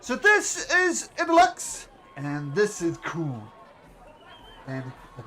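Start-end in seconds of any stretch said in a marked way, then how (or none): 0.0-3.4 presenting volunteers. Acted.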